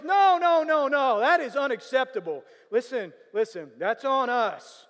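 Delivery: loud, high insistent manager. Retold or imitated. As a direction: wave away any objection, eager to make it right. Manager insisting on comping meal.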